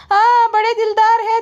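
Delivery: high, loud sarcastic voice; high loud mock agreement; mocking brother-in-law. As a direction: squeal it back sarcastically. Mocking reply to the wife.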